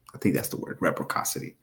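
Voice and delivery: real whispered self-check; whispered aside. Real, not acted. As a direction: mutter it to yourself, unsure. Unsure aside about a word.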